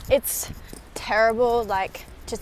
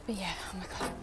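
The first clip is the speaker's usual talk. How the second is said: hushed, sheepish whisper; embarrassed whisper; 2 semitones lower, 11 dB quieter, faster than usual. Real, not acted. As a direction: whisper it so nobody hears. Embarrassed by people nearby.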